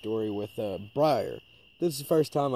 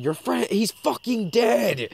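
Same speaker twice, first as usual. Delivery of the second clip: slow, high, loud taunting yell; 10 semitones higher, 5 dB louder, much slower than usual. Retold or imitated. Yelling at the armadillo as the car passes, slow loud taunt. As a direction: stretch it out like a warning. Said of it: "And I yelled".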